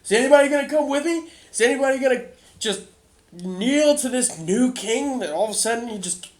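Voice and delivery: high, loud, defiant protest; doubting townsman challenges the new king, loud; angry townsman. Acted. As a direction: shout him down in disbelief. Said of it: "He goes".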